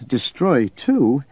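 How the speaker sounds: drawn-out, unfinished thought; trailing off. A narrator's reading. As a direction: let the words hang, slowing down. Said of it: "he trailed off".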